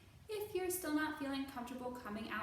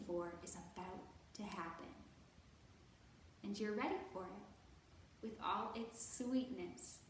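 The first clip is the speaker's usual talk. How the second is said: put-on gentle, measured read-aloud for children; storybook narration; 3 semitones lower than usual. A narrator's reading. Librarian reading a picture book to kids, gentle narration.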